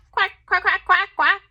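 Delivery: high, quacky duck sounds; kid-friendly duck imitation; duck quacking. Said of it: "gave a quack".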